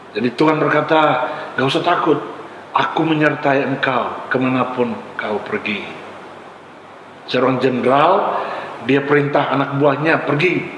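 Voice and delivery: usual speaking voice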